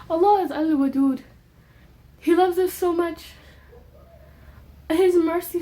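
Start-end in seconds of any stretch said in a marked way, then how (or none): none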